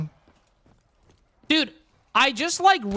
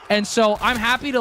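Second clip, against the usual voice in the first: usual speaking voice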